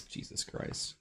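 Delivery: breathy shocked whisper. Real, not acted. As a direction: whisper it under your breath.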